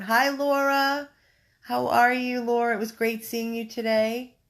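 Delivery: high, loud, delighted hello; cheery greeting. Real, not acted. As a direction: greet a friend who just joined. Greets a viewer joining.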